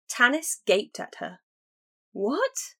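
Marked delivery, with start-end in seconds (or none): 2.1-2.8 shocked. A narrator's reading. Tanis gapes in shock.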